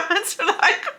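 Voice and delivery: laughing. Real, not acted. Laughing, high.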